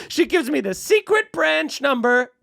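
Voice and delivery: normal talking voice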